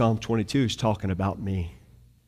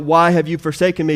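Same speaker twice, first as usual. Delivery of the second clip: quoted louder and faster, a touch of crying out; mild mock cry; 7 semitones higher, 8 dB louder, faster than usual. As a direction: lift the quote into a half cry. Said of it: "He cries out".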